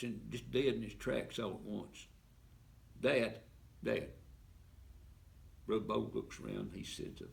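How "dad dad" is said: calling out. Acted. Son spots the hitchhiker, calls father.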